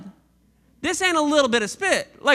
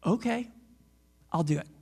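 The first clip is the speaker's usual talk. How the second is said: quiet resigned blind man; 8 semitones lower, 7 dB quieter, faster than usual. Acted.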